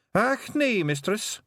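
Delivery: a wee Scottish pixie chirping up to Tiffany; Feegle pixie voice. Acted. Pixie telling Tiffany his long name, high and eager.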